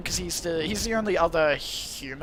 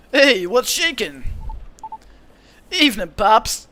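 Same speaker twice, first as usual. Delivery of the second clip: put-on laid-back, slow Californian accent, a bit louder; drawn-out, easygoing California dude accent; 4 semitones higher, 4 dB louder than usual. Satyr greeting the old cop in a Californian accent. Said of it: "I'll give him, like, a Californian accent".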